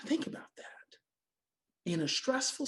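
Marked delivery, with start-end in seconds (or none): 0.1-0.6 whisper. Real, not acted. Pause after key point.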